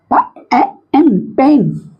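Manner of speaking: "प, ए, एन" separately, then blended "पेन"; teacher builds "पेन" from "प", "ए" and "एन"; sounding out. Shown as a demonstration. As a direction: spell by sound with pauses, then join into "पेन". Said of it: "दोनों मिल करके जो उच्चारण बनाते हैं".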